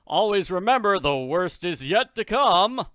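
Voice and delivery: hearty booming Daddy. Acted. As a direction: boom it with mock cheer. Daddy hearty taunt, loud.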